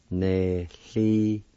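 Nehliosege drawn out slowly; slow demo word. Shown as a demonstration. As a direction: stretch Nehliosege out for a learner. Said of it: "It's pronounced like this".

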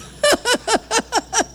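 bursting into laughter; laughing. Real, not acted. Preacher joking to the congregation about her snoring husband, laughing. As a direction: laugh through the joke about the bear.

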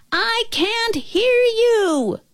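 high, drawn-out mock holler; shouting back. A narrator's reading. Nobble answering the faraway girl, high stretched-out shout. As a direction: stretch it out slowly, calling far off. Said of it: "the Nobble shouted back".

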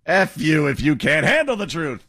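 hammed-up movie colonel line; mock Jessup rant. Host mimicking the anchor's movie-colonel bluster, hammed-up. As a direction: echo the blustering anchor, mildly. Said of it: "He went full Colonel Nathan Jessup there".

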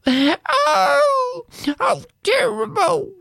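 very high, wailing moan. Acted. Wife moaning about sausages stuck on her nose. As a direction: wail over the sausages. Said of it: "she moaned".

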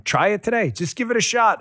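upbeat push. Acted. Ad pitch push.

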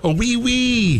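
hammed-up high French accent. Host showing off his work-in-progress French accent, hammed-up French. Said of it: "I'm working on a French accent".

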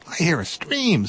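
high, bright little mouse voice; eager little mouse. Acted.

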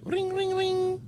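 high, soft trilled ringing; imitated phone ring. Host starting Anna's phone trial run, mock ringing. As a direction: mimic a telephone ringing in a small high voice. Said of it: "Do we give Anna a little trial run?".

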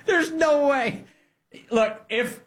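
high-pitched, through laughter; can barely talk for laughing; incredulous, cracking up; laughing disbelief. Real, not acted.

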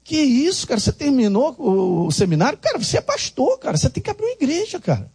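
high, fast, eager friend talking him up; an eager friend hypes him up to be a pastor, high and fast; excited buddy. Retold or imitated. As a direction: gush like a pushy friend. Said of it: "um amigão dele chegou e falou assim".

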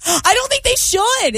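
high laughing protest; giggling insistence. Real, not acted. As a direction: laugh through the words. Laughing while joking.

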